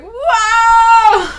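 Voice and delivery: loud, high, drawn-out wow; awestruck shout. Acted. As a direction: belt out mock-cinematic amazement. Acts out awe at movie moment.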